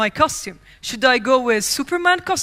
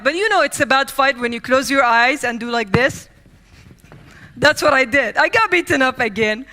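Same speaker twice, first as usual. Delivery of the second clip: ordinary talk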